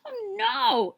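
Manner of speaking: high alarmed cry; frightened cry. Acted. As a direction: gasp it out in fright. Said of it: "she cried".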